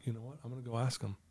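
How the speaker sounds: very soft thinking aloud; private resolve. Retold or imitated. Student privately decides to ask the girls, hushed resolve. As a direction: murmur the decision under your breath.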